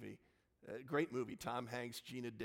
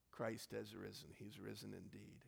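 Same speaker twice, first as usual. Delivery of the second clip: whispered response; 4 semitones lower, 9 dB quieter than usual. Real, not acted. Pastor answering his own Easter greeting in a whisper. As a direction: whisper it softly.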